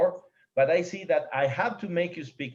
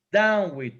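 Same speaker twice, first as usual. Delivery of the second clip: "down" punched louder; downbeat stressed; 3 semitones higher, 5 dB louder than usual. Shown as a demonstration. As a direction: land hard on "down" like the first beat. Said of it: "So it's down with".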